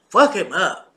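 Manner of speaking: ordinary talk, nothing marked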